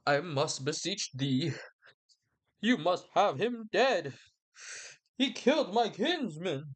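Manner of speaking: slow, theatrical plea climbing high; pleading Capulet. Retold or imitated. Capulet begging the Prince for vengeance, hammed-up pleading. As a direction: beseech with drawn-out, hammed-up drama. Capulet begs for Romeo's death.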